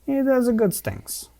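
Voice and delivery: put-on high, hammy Italian accent voice. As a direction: play it like an Italian maestro. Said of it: "I'm gonna stop doing the Italian accent".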